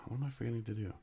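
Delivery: a touch of confusion; puzzled self. Retold or imitated. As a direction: ask yourself lightly puzzled. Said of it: "be like".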